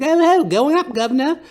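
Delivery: very high, loud, silly posh British voice; goofy high-pitched mock British royal; Prince Harry impression. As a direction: ham up a squeaky toff prince. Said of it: "he's like".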